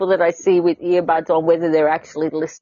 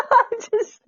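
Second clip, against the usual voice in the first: high, helpless giggling; can't stop giggling, really losing it; giggling fit. Real, not acted. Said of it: "don't get me giggling".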